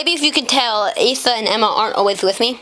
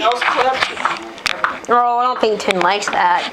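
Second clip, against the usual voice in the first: ordinary talk, nothing marked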